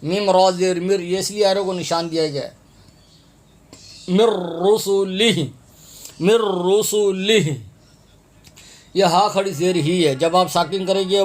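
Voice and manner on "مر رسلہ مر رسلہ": "مر رسلہ" slow, with a long doubled r; merged m and r. Shown as a demonstration. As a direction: join "مر رسلہ" as mirr-rusulih, rolling the r. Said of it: "میم اور را کو ملا کے پڑھیں".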